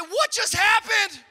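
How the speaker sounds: high, very loud mock outrage; hammed-up bewildered yell; incredulous shout. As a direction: shout it slowly in stunned disbelief. Comic shouted reaction to wife's outburst.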